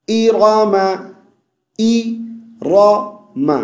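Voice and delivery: इरामा chanted "i-rama", heavy ra; correct short i. Shown as a demonstration. Teacher models the word, इरामा chanted with clear i. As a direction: start इरामा on a clear i, round the ra. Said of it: "ई को ए नहीं पढ़ना".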